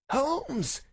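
high, drawn name-call; insistent waking call. Acted. Visitor awakens the sick man, insistent call. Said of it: "insistent tone of one who awakens a sleeper".

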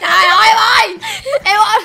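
high, very loud alarm; real startled yell; shocked cry. Real, not acted. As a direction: shout out in startled dismay. Shock at baby's mishap in game.

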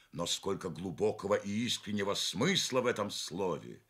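plain reading voice

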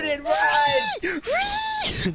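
high drawn-out shout to run; panicked shout. Real, not acted. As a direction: scream at him to run. Enemy chasing teammate.

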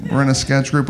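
usual speaking voice

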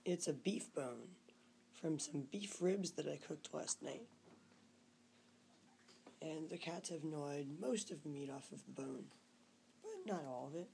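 hushed, half-whispered talk; talking softly. Real, not acted. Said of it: "I'm talking really softly because Eugene's still asleep".

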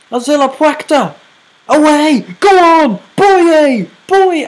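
high frantic yelling; frantic shouting. Real, not acted. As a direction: scream the names in panic. Frantic final moments commentary.

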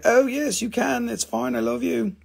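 high-pitched, cooing devotion; doting reply. Retold or imitated. Seller answering the beloved mineral, doting reply. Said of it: "And I'm like".

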